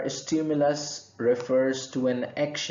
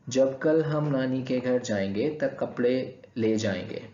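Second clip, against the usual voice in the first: fast Urdu example; much faster than usual. Shown as a demonstration. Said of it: "The second example is".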